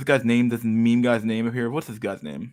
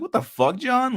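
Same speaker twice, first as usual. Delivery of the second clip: incredulous; 13 semitones higher than usual. Retold or imitated. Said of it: "It's like".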